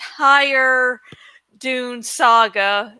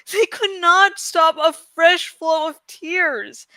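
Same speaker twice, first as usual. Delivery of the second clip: higher, grave storyteller voice, slowing into the sorrow; dramatic story narration; 6 semitones higher than usual. A narrator's reading. Reading Eragon aloud to Patreon listeners, dramatic narration. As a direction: read it like a tragic fantasy epic.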